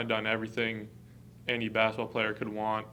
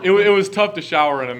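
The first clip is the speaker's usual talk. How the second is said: laughing; 8 semitones higher, 14 dB louder than usual. Real, not acted. Teammates joking about old showers.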